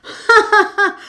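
high, loud burst of ghostly laughter; booming laughter. A narrator's reading. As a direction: throw the head back and roar with laughter. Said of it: "bricht in schallendes Gelächter aus". (schallendes, hohes Gelächter)

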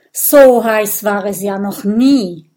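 loud, weary groan of complaint. A narrator's reading. Little dragon moaning to his friend about heat, groaning. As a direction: groan it out, stretching the words. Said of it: "stöhnt Kokosnuss".

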